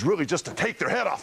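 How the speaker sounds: high, building playful threat; mock-menacing shout. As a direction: build up to a wrestler's attack. Wrestler demonstrates move on volunteer.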